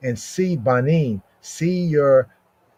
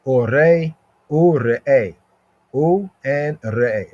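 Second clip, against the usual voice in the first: U and re'eh drawn out slowly, every sound pulled apart; slow and emphatic; slower than usual. Shown as a demonstration. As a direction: slowly stretch U and re'eh to stress it. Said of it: "If I talk slow".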